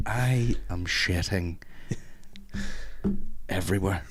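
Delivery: very slow flat confession; slow deadpan. Retold or imitated. Caller phoning in sick, slow and deadpan. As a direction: drag each word out, deadpan. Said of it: "just ring up and be like".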